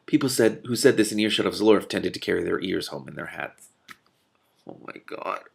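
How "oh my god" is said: whispered through laughter; barely voiced, losing it; hushed giggling exasperation; breathless aside. Real, not acted. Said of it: "I can't do this".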